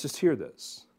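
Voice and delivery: ordinary talk, nothing marked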